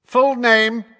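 high, loud official demand. Acted. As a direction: call it out loudly like a court official. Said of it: "said Fudge loudly".

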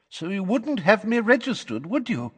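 high, quavery witness voice; Missus Figg. Acted. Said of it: "in her quavery voice".

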